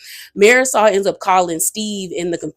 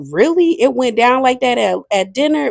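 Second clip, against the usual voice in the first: high-pitched mock surprise, imitating her; sarcastic; 5 semitones higher than usual. Retelling a cast member's reply about the dinner fight, sarcastic. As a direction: feign astonishment in a raised, sarcastic voice. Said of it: "she's doing it sarcastically".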